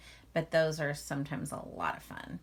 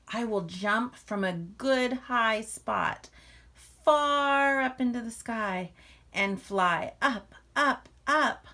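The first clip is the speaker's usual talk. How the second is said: slow, building higher and louder to a soaring "up, up"; excited little bear; 5 semitones higher, 7 dB louder, slower than usual. Acted. Little Bear dreaming of flying, climbing higher and louder. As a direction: lift with each word like taking off. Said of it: "Little Bear thought".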